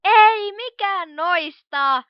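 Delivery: loud, slow, fed-up answer; exasperated. Acted. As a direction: stretch it out in annoyance. Exasperated by endless guesses.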